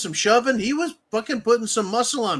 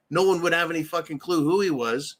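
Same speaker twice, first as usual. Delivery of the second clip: ordinary delivery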